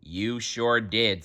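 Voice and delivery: plain reading voice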